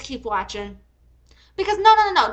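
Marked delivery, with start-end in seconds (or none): 1.6-2.3 higher and louder, rapid refusals; angry loud no's. Real, not acted. Raging at the seller's police threat, loud angry no. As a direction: shout them down. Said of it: "I got mad".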